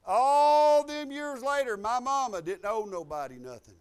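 loud, high, ringing pulpit delivery; full-force preaching. Acted. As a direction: drive the point home at full voice.

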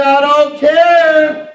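I don't care stretched long and slow; slow, firm I don't care; slow drawn-out declaration. Shown as a demonstration. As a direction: say I don't care like you mean it. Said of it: "Some of y'all need to say".

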